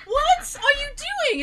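high, drawn-out mock disbelief; appalled protest. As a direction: stretch the question out high, appalled. Retold protest to sister.

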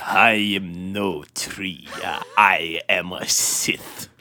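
very slow, deep, whispery Sith voice; Darth Sidious. Acted. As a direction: draw out every word low and menacing. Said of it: "you sound like Sidious".

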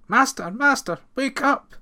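high, loud alarmed maid; a startled maid calling her master awake; frightened cry. Acted. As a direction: cry out in a panic to wake him. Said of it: "she cried".

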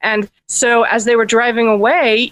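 usual speaking voice

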